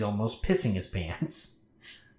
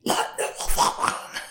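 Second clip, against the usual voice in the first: weirdly toned, wordless mimicry of speech; like a cat trying to talk; halting creature noise. The thing outside the door, halting inhuman babble. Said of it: "in this halting, weirdly toned voice".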